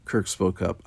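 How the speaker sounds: usual speaking voice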